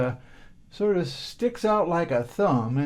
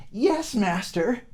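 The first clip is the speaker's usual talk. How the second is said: put-on eager servant boy; 7 semitones higher, slower than usual. Servant boy answers master.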